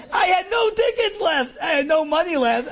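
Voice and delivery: high and loud, laughing at the disaster; comic despair, shouted high; laughing exasperation. Real, not acted. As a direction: cry it out half-laughing.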